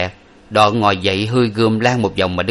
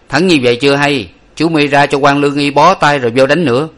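louder, firmer command; commanding swordsman; 5 semitones higher, 5 dB louder than usual. A narrator's reading. Hero tells the wounded rival to get bandaged, loud. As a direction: speak down to the beaten rival.

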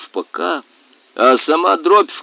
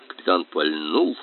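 usual speaking voice

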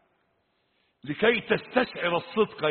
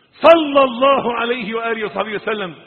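high, loud, fast-moving declamation; full-force preaching; 5 semitones higher, 9 dB louder than usual. Real, not acted. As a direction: ring out the blessings to the congregation. (وعظ جهوري متدفق)

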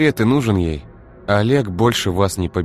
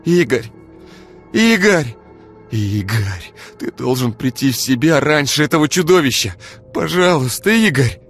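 high, loud desperate cries of his name; begging an unconscious friend to wake up, desperate and loud; desperate calling; 9 semitones higher, 6 dB louder than usual. Acted. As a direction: shake him awake with your voice. Ira shaking unconscious Igor.

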